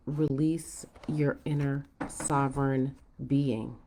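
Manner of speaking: ordinary talk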